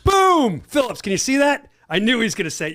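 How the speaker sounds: loud, high burst of told-you-so glee; crowing about guessing the answer; triumphant shout. Real, not acted. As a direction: shout it out, thrilled to be right. Predicted answer comes true.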